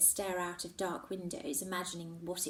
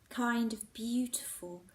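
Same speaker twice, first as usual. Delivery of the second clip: plain reading voice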